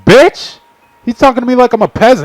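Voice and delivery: furious squeal at being treated like a servant; outraged shriek. Real, not acted. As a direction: explode in offended disbelief. Reader outraged at character.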